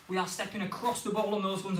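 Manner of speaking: normal talking voice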